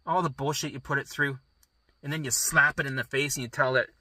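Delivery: ordinary talk, nothing marked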